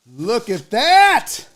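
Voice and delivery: slow, high-pitched marvelling; drawn-out delighted awe; excited amazement. Real, not acted. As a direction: stretch THAT out, rising high. Repeats it, amazed.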